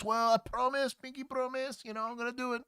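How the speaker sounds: high mock Mexican accent, pleading; a hammed-up Mexican accent that slips into Arabic. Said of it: "your Mexican accent there sounded Arabic".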